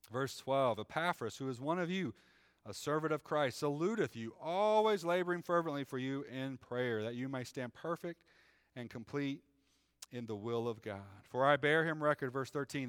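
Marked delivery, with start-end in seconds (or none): 0.8-12.3 measured, raised reading voice; scripture reading. Acted. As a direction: read it like the pulpit Bible. Reads scripture aloud.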